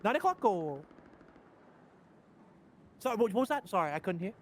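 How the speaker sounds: apologetic mock Australian; mimicking co-host. Impersonating the co-host who misheard, apologetic mimicry. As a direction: keep apologising in a teasing impersonation. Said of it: "Do an impression of me".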